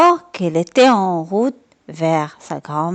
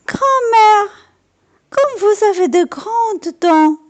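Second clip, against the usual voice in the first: put-on much higher, amazed little girl; wondering girl; 11 semitones higher, 3 dB louder than usual. A narrator's reading. Girl staring at the wolf in bed, amazed. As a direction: marvel at her big ears.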